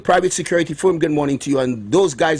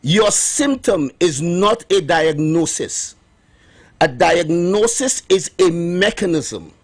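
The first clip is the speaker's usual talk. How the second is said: slow and deliberate, pausing on each word; slow, emphatic; slower than usual. Shown as a demonstration. Host repeating his key point to radio listeners, slow and deliberate. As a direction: spell it out slowly for the listeners. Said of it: "Let me repeat myself".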